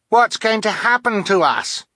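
put-on high, loud frightened child scout; fearful. A narrator's reading. Captured Snow Scout asks what happens next, fearful. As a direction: wail it in fear. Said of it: "asked one of the Snow Scouts fearfully".

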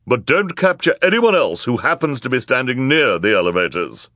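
high, loud character voice; bossy woman in crowd. A narrator's reading. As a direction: call out a caveat to the crowd. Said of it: "added the woman with hair but no beard".

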